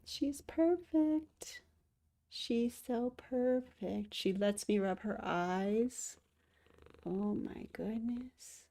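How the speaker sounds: softly cooing to her cat; tender cooing. Real, not acted. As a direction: coo slowly over the cat.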